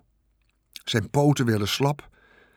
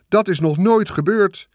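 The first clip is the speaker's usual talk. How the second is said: high, louder announcer protest; raised public-address voice; loudspeaker announcer; 9 semitones higher, 5 dB louder than usual. A narrator's reading. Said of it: "zei de luidspreker".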